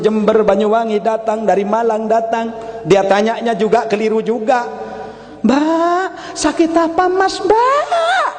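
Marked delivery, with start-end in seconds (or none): 5.5-8.3 much higher mock-weepy voice; fake crying. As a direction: pretend to sob while asking, pitched up. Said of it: "Pura-pura nangis juga". (pura-pura menangis dengan suara tinggi)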